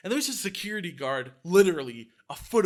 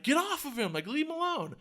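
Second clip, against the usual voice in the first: very high imitated shout, volume held back; mock crowd yell; 11 semitones higher than usual. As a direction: call out high like an onlooker. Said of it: "people are yelling".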